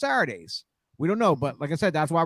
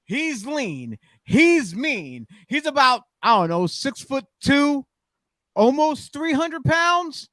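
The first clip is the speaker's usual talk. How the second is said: drawn-out, raised-pitch hype building to a shout; ring announcer intro; 7 semitones higher, 4 dB louder, slower than usual. Acted. Host introducing T Reyes as surprise guest, ring announcer hype. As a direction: announce the wrestler, stretching words up to a big name shout.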